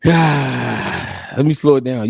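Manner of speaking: slow, low, drawn out; sigh, then slow it right down. Shown as a demonstration. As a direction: take it slow and deliberate.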